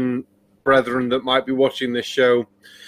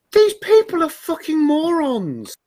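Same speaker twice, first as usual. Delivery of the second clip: exasperated outburst; 12 semitones higher than usual. Real, not acted. Exasperated rant about the video.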